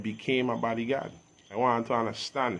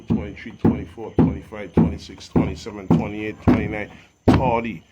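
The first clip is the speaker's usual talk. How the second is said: counting up, getting louder with each number; building shout; 10 dB louder than usual. Real, not acted. Rapper defying doubters about surviving, rising loud shout.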